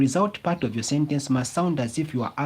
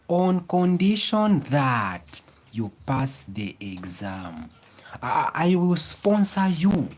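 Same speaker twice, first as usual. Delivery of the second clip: slow, deliberate read-aloud of the example; teacher reading the example sentence aloud slowly; slower than usual. Shown as a demonstration. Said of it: "It says".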